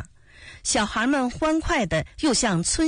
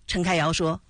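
usual speaking voice

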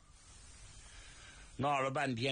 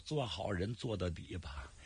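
plain reading voice

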